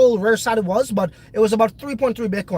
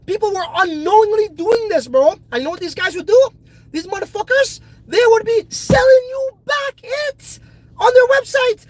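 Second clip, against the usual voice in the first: heated rant; 9 semitones higher, 4 dB louder than usual. Real, not acted. Ranting about greedy service provider.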